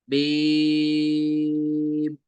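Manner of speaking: बीम held long and level; three-alif elongation. Shown as a demonstration. Teacher demonstrating joined letters, बीम drawn long. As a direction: stretch बीम out over three counts. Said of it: "बीम को तीन अलिफ".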